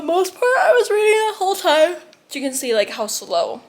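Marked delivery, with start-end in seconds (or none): 0.0-1.9 laughing. Real, not acted. Laughing over her distracted hour.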